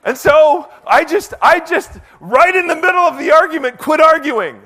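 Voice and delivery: high, very loud, emphatic telling; exclaimed climax. Real, not acted. Preacher telling how he quit arguing mid-fight, loud exclamation. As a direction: belt out the turning point of the story.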